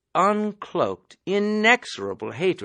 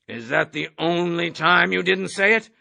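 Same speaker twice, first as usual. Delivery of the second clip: ordinary delivery, nothing marked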